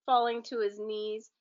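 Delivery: ordinary delivery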